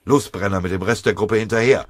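loud, urgent command; shouted order. A narrator's reading. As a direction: push them forward with a shout. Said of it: "den Befehl".

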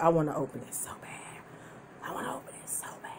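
hushed, aching with temptation; very soft, breath-only confession; longing whisper. Real, not acted. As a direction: whisper it like a secret craving. Confiding temptation to open package.

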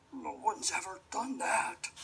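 wolf voice. Acted. Sleepy weepy wolf.

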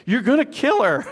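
panicked relatives. Retold or imitated. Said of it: "they're all like".

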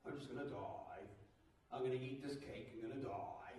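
put-on low, soft, self-pitying old-person voice; mopey old person. Pastor imitating a gloomy elderly person. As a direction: droop it like a gloomy old-timer. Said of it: "You ever talk to anybody like that?".

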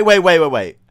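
usual speaking voice

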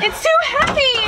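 high strained voice; straining, alarmed. Real, not acted. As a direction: call out while struggling to hold. Holding heavy roof beam.

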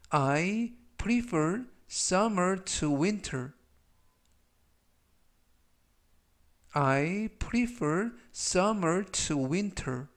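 each word drawn out for repetition; very slow read. Shown as a demonstration. Teacher reading example sentences for learners to repeat, very slow. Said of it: "제가 역시 천천히 세 번씩 읽어드리고요".

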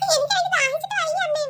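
put-on high child voice whining to mum; a thirsty little kid pleading; child asking mother for water; whiny child.

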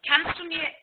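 usual speaking voice

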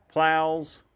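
usual speaking voice

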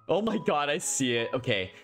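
usual speaking voice

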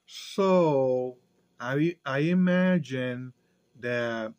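jaw pressed by hand; talking with fist at chin; chin resting on fist. Real, not acted. Seen 0: Fist pressed under chin and lower lip, mouth mostly visible; gone by 3.5.

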